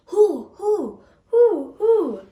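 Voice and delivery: high, loud hooting owl. A narrator's reading. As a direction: hoot like an owl in the night.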